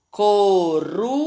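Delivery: CORUJA sounded out with a weak tapped R. Shown as a demonstration. Teacher confirming one R's sound, weak R in "Coruja". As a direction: say "Coruja" by syllables, keeping the R weak. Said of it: "o som fraco".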